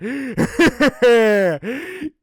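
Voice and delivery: loud wicked cackle; loud evil chuckle; villain laugh. Acted. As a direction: laugh like a pouncing dingo. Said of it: "The dingo just laughed".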